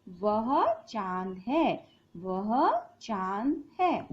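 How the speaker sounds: वह चांद है said slowly, वह leading; slow model sentence. Shown as a demonstration. Teacher models the sentence slowly for pupils. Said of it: "तो हम क्या बोलते हैं?".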